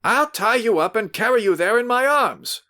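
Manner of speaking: high, loud threatening yell. Acted. Host yells at drunk visitor he'll drag him along. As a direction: bark out the threat. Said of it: "he yelled again".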